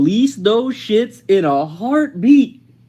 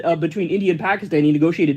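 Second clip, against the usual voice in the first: ordinary talk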